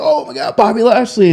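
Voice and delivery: high, thrilled gasp of recognition; starstruck fan. Retold or imitated. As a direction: squeal it like a fan spotting his hero. Store manager recognizes the wrestler.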